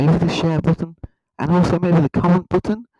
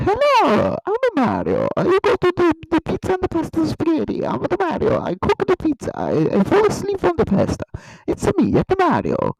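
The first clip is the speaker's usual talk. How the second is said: very high cartoon Italian accent; high-pitched mock-Italian game hero voice; Mario; 13 semitones higher than usual. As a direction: squeal it like a hammed-up Italian plumber. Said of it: "should have speak like this".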